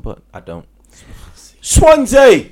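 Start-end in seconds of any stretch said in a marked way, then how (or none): 1.6-2.5 shouted, high and loud; loud announcement. Acted. Host introducing the next team with a shout. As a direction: call out the club name like a stadium announcer.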